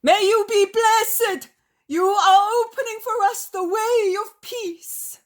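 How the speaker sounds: very high, loud crowd cry; cry of liberation. A narrator's reading. The crowd blesses the master after breaking the spell, cry of liberation. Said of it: "finally utter a cry as of liberation".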